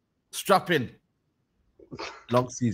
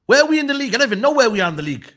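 higher, louder and fast; exasperated rant; 6 semitones higher, 7 dB louder, much faster than usual. Real, not acted. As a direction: vent at the club's state. Angry rant about club's form.

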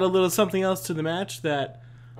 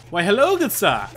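louder, playful formal hello; mock-gentleman greeting; 3 semitones higher, 5 dB louder than usual.

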